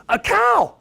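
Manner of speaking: amazed, high, loud exclamation; excited boy. Retold or imitated. Boy seeing his first cow from the car, excited cry. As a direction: imitate a kid thrilled by the sight. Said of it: "but he's excited".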